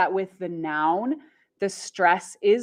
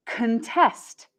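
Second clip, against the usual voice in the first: contest as kuhn-TEST; verb stress on second syllable. Shown as a demonstration. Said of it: "we have the verb".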